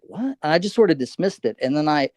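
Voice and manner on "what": much higher, soft startled "what"; surprised. Retold or imitated. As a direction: caught off guard, a quiet squeak. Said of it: "And I was like".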